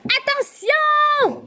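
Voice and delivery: high, drawn-out yell; screamed warning. A narrator's reading. Group warning Anne-Lo of the car, screaming. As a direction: stretch the warning out in a high cry. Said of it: "Le reste du groupe hurle d'une même voix".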